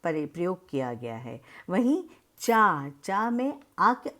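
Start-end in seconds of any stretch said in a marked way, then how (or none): 1.7-4.1 चा said slowly with a long आ; slow, emphatic demonstration of चा; stretched चा. Shown as a demonstration. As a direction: hold the आ in चा. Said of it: "आ सुनाई दे रहा है".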